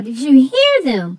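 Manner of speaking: plain reading voice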